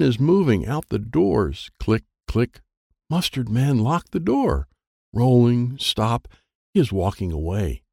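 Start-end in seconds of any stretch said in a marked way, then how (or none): none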